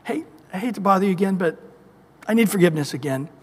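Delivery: fast, embarrassed request; quick, apologetic mumble; sheepish rush. Acted. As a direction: rush it out, hoping not to be noticed. Sinner returning again.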